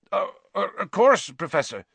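high, flustered happy stammer; stammer, overcome with relief; weak with happiness. A narrator's reading. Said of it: "sounded weak with happiness".

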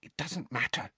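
soft, high-pitched hush; whispered. A narrator's reading. Hermione calms her angry friends, whispering. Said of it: "Hermione whispered".

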